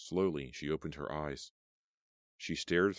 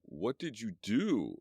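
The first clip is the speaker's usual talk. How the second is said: slow, higher groaning voice of an injured man; hurt man calling from a wreck; 6 semitones higher, slower than usual. Acted. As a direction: drag it out in pain. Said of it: "Lloyd called".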